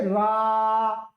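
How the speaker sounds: रा with the आ stretched very long and slow; stretched vowel. Shown as a demonstration. Teacher showing pluta vowel on रा, very slow stretch. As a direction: pull रा out far past a normal long vowel. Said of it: "इसकी आवाज खींचना".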